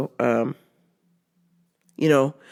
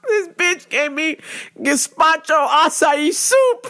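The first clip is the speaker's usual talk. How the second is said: laughing; 10 semitones higher, slower than usual. Real, not acted. Laughing through the punchline.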